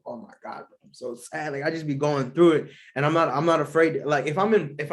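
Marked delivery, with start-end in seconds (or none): 0.1-1.5 much softer, deflated self-pity; mock sad moan. Imitating himself when sad, a hushed mock moan. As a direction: play up the hurt in a near-silent, mopey voice. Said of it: "I'll be like".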